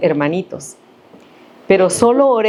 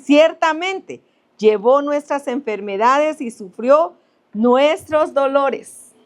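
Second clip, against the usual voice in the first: slow, raised solemn reading of the verse; scripture reading; 6 semitones higher, slower than usual. Acted. Preacher reading Isaiah to the congregation, slow and solemn. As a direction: lift each word of the verse slowly.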